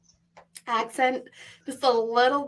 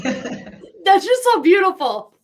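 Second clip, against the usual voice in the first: laughing; 7 semitones higher, 7 dB louder, slower than usual. Real, not acted. Host charmed by French accent.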